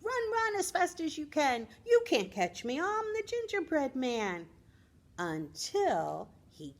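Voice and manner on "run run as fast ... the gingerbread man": high, taunting cookie voice, calling out; cheeky gingerbread man shouting back over his shoulder; playful high singsong taunt; gingerbread man yelling. Acted. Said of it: "down the lane yelling".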